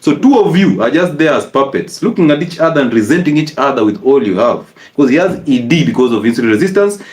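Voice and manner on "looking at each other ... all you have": ordinary talk, nothing marked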